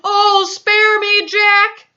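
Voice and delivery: slow, high, loud plea; pleading yell. A narrator's reading. Dreaming giant begging Jack for mercy, pleading yell. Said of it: "he yelled".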